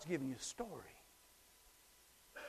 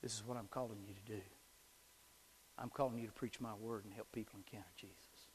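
much softer, hushed voice of God, fading to a whisper; God's quiet voice; 7 semitones lower, 11 dB quieter than usual. Retold or imitated. God calling the boy to preach, hushed and fading to a whisper. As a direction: say it gently, as God speaking into a boy's heart. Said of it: "God slammed my heart and said".

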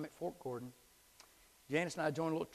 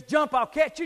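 louder, higher urging; rising call; 13 semitones higher, 15 dB louder than usual. Acted. God calling the doubter over the cliff, louder. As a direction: press the call harder. God's call keeps building.

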